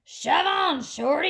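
loud, high rude yell; pushy bus shouting at a small truck. Acted. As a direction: shove the little truck aside with a bellow. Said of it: "yelled a double-decker bus".

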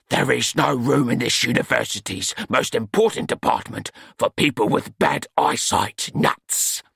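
a hint of a stern old master; stern old Smeems. A narrator's reading. Smeems rebuking Nut, lightly stern master. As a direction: cut him off with pompous authority.

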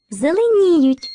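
Зеленіють slowly, stress on НІ; Зеленіють with a long, high third syllable; showing the stress. Shown as a demonstration. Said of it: "Визначаємо наголос".

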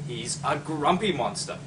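ordinary delivery, nothing marked

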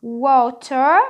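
American WATER, loud 'wo-ter' with clear t; American accent. Shown as a demonstration. Teacher gives the American option, American 'wo-ter'. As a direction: say water American, t sounded, r at the end. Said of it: "الامريكان اكسنت بتاعت الورد دي بتقول".

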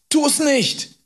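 very high, pleading cry; terrified scream. A narrator's reading. Boy begs man with knife not to kill, screaming. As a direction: scream in panic for your life. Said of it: "schrie ich".

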